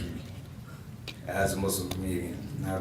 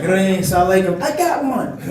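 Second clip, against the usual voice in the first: mock phone greeting, laughing, higher and louder; laughing through the quote; 9 semitones higher, 12 dB louder than usual. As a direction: blurt it out mid-laugh, pitched up. Acting out cold call to Muslims.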